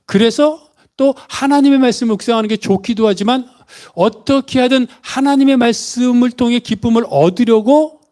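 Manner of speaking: ordinary delivery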